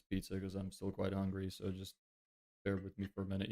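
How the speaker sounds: soft aside between bites; mouth full, quiet. Real, not acted. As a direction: mumble while chewing. Eating pizza on stream.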